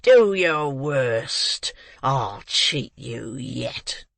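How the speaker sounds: very slow, defiant threat to the crowd; menacing defiance. A narrator's reading. Ruffian at the window menacing the crowd, slow and defiant. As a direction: spit defiance at them slowly. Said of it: "menacing the crowd".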